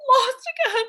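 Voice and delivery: very high, loud tearful whine; mock hysterical sobbing. Little girl upset over Lassie, hammed-up sobbing. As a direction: wail it like an overwrought little girl. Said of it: "without sobbing hysterically".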